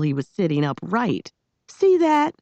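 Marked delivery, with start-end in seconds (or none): 1.7-2.4 high, sharp snap; snapped. A narrator's reading. Girl scolding the boy she woke, snapping. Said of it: "she snapped".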